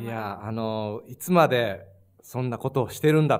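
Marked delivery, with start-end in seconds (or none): 1.1-3.4 low, firm scolding in Japanese; angry Japanese parent. Retold or imitated. Guest shows hosts how parents scolded, mildly angry. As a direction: imitate a parent's stern telling-off, louder and low. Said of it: "gimana marahnya?".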